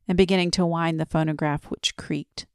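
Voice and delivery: usual speaking voice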